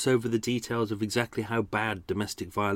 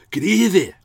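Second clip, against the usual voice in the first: one word in broad Glaswegian, pitched high and loud; Glaswegian accent; 9 semitones higher, 9 dB louder than usual. Shown as a demonstration. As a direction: punch it out like a harsh Glaswegian. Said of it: "So here was my Alexander Moyer".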